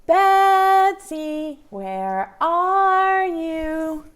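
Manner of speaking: high, drawn-out call to a hiding friend. Shown as a demonstration. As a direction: call out slowly, long and high. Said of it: "Call for her".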